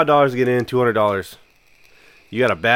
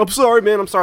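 pleading apology; 9 semitones higher, faster than usual. Retold or imitated. Drunk soldier apologizing, retold.

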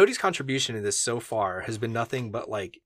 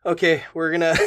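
normal talking voice

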